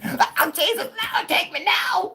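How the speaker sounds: very high, loud wailing plea, slow on the last part; funeral mourner wail. Retold or imitated. Imagining himself at the funeral as a wailing mourner. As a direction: wail like a grieving church lady at a funeral.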